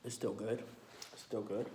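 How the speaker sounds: ordinary delivery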